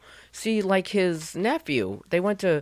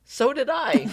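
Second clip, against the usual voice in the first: much higher voice for her own answer; high-pitched retold reply; 9 semitones higher, 3 dB louder, slower than usual. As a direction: quote yourself high and eager. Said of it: "listen to the way I talk".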